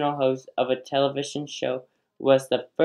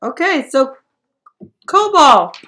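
laughing; 9 semitones higher, 6 dB louder than usual. Real, not acted. Host laughing through question.

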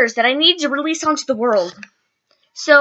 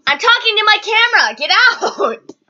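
high, raised-voice irritation; real annoyed yell; 8 semitones higher, 3 dB louder than usual. Real, not acted. Vlogger to interrupting little sibling, really annoyed yelling. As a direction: shout the intruder out of the room.

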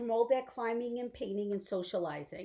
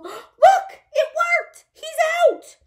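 high, loud, gasping delight; excited surprise; 12 dB louder, slower than usual. Pretend. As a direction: gasp and exclaim at the sudden appearance. Crunch appears after the song.

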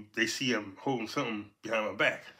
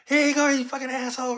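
high, loud yelling cop; angry cop shout; 7 dB louder than usual. Retold or imitated. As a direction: bark it like a cop yanking a suspect out. Cop drags him from bushes.

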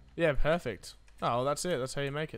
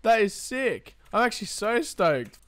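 higher, louder thrilled praise; stoked, still buzzing; real excitement winding down; excited; 7 semitones higher, 7 dB louder, slower than usual. Real, not acted. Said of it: "I'm a little bit excited".

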